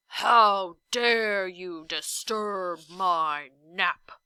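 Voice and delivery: slow, loud giant's roar; furious bellow. A narrator's reading. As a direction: drag out the giant's outrage. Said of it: "he bellowed furiously".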